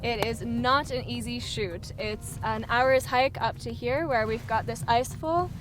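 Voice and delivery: normal talking voice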